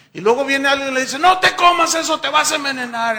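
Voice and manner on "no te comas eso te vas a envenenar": loud, high cry not to eat it; urgent shouted warning. Acted. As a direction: shout to stop someone about to be poisoned. Someone warns the believer.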